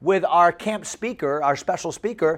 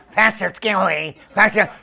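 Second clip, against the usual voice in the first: annoying kid's put-on voice; Bobby; 2 semitones higher, 3 dB louder than usual. Big-toothed camper pestering his pastor, goofy voice. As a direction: mimic a goofy, toothy boy calling out. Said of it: "And he talked like this".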